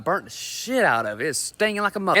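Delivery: ordinary delivery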